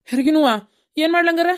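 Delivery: flustered, high-pitched questioning; alarmed. Acted. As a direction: ask in a panic. Father alarmed by labour news.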